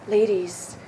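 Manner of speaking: lightly voiced ad pitchman, smooth and selling; a hint of a late-night infomercial host; mock TV commercial. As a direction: pitch razors to the ladies like a cheesy ad. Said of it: "it was like".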